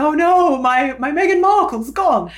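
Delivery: high, loud comic lament; mock dismayed date. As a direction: wail it as a crestfallen suitor. Voices the disappointed date.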